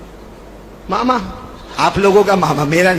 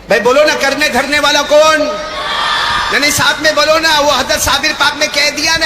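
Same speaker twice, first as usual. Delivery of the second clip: preaching at full force; 5 semitones higher, 3 dB louder than usual. Acted. Preacher drives the crowd to answer, loud fervent call. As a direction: rouse the gathering like a fiery preacher.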